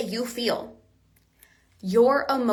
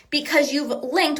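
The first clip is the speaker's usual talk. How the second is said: usual speaking voice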